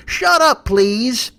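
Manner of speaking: high, loud burst, easing on please; mock irritated retort. Mock Sessions silencing a heckler, high irritated retort.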